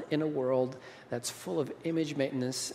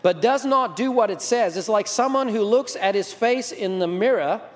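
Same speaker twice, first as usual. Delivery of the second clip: loud, raised-pitch pulpit reading; emphatic scripture reading; 7 semitones higher, 7 dB louder than usual. Acted. Preacher reading James to the congregation, emphatic and loud. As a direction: proclaim the verse with force.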